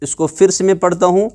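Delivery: usual speaking voice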